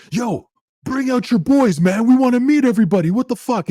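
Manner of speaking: put-on high, loud drunken demand; mock a rowdy drunk yelling down the hall. Drunk hockey players yelling at Turk, high and loud.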